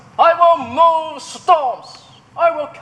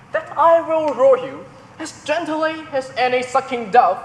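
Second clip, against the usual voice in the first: normal talking voice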